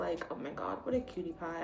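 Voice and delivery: usual speaking voice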